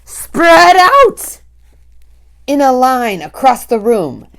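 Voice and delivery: loud, high witch barking orders; commanding witch. A narrator's reading. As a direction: order the hunt like a tyrant. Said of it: "screamed the Grand High Witch".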